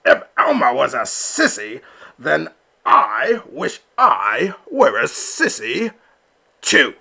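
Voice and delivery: loud, defiant father; papa defending his son before the flock; booming. Acted. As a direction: boom it out over the crowd. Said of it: "a voice boomed forth".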